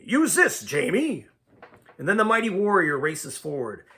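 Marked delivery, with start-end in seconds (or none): 0.0-1.3 urgent higher, louder call; mild battle cry. A narrator's reading.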